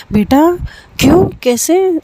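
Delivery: high, rising startled concern; aunt suddenly worried when her niece says her head aches; surprised worry. Acted. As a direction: jump up in alarm for the niece. Aunt alarmed niece feels unwell.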